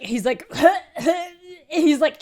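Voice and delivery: laughing hard. Real, not acted. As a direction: crack up mid-sentence. Laughing while retelling.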